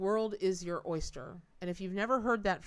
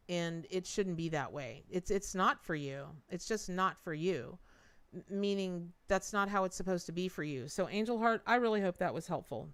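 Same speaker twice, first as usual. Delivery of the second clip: ordinary delivery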